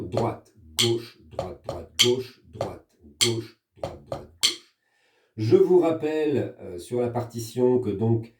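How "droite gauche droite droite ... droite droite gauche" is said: GAUCHE on the accent, DROITE DROITE tucked in lightly; rhythmic sticking demo. Shown as a demonstration. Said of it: "on répète toujours la première mesure".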